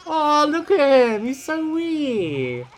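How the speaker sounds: put-on very high, slow baby-talk coo over something tiny; high, drawn-out squeal of delight. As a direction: gush like it's the cutest thing alive. Cooing over a small animal.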